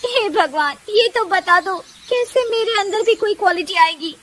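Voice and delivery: high, whimpering plea to God; tearful daughter-in-law. A narrator's reading. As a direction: lament breaking into crying. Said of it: "वो रोने लगती है".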